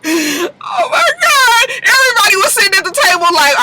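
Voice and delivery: laughing. Real, not acted. Cracking up at her own story.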